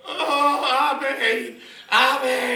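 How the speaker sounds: put-on high, loud drunken wail. Drunk mate calling his girlfriend from the toilet, loud high wail. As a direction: wail for her like a helpless drunk. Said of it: "was just going like".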